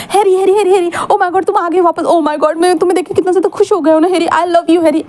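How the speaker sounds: hammed-up gushing, high and fast; mock excitement. Wing mocking how excited he should act for Harry, hammed-up gush. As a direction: overplay the joy as a sarcastic joke. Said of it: "ऐसे बोलूँ, बताओ".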